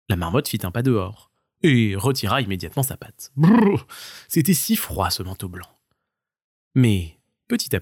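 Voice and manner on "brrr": shivering brrr. Acted. Paw in cold snow.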